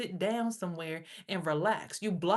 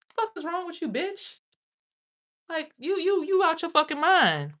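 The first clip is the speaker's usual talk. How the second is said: ordinary talk, nothing marked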